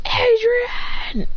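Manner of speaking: high, anguished name call; stretched high-pitched shout of the name; Alex. Acted. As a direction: cry out for a falling friend. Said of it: "Alex goes".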